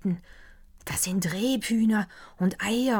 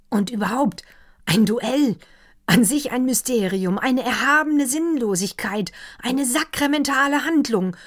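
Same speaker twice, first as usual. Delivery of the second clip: a lightly voiced doctor, higher and louder, carried away; enthusiastic doctor; 7 semitones higher, 7 dB louder than usual. Doctor raving to the counts over dinner, higher and louder. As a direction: let the doctor gush over the dark forest. Doctor rhapsodizing about duels.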